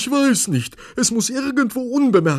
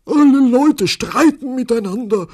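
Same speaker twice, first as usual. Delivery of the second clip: very high, loud wail; frantic, high-pitched complaint; desperate conductor; 16 dB louder than usual. A narrator's reading. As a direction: cry out at wits' end. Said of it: "sagte der Schaffner verzweifelt".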